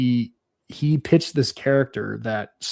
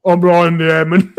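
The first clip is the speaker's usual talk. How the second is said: goofy idiot NPC; 4 semitones higher, 8 dB louder than usual. Retold or imitated. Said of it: "I was like".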